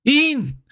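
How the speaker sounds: high mock Ian said as EEN; one-syllable Ian. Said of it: "they think they're saying Ian".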